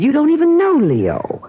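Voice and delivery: high, rising urgent push; insistent protest. Acted. Wife urging her lover about her husband, high insistent protest. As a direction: press him hard to change his mind. She pushes back on his refusal.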